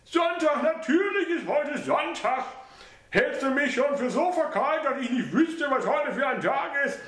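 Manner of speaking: high mother's voice, offended; offended old mother. A narrator's reading. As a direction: bristle at the insult.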